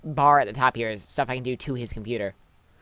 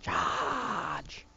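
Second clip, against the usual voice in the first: whispered command; 2 semitones higher, 9 dB quieter than usual. Acted. Commanding in game.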